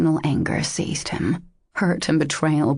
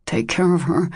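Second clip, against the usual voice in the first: ordinary delivery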